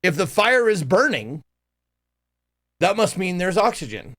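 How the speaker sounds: ordinary talk, nothing marked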